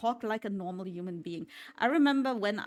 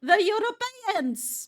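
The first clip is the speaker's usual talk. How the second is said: high, loud and slow exclamation; mock excited exclamation; 12 semitones higher, 7 dB louder, slower than usual. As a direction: draw it out with exaggerated delight. Echoing the clip in excitement.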